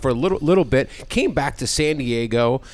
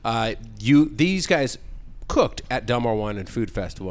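usual speaking voice

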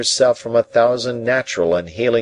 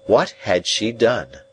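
ordinary delivery

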